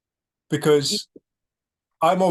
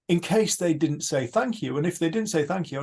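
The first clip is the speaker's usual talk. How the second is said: normal talking voice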